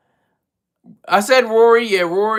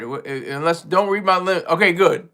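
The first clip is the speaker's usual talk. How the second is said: ordinary delivery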